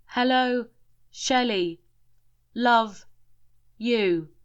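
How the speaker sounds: halting words, long pauses between each; spaced out, slow, with pauses. A narrator's reading. Spirit Martin speaking word by word with pauses. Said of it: "a three-second pause between each one".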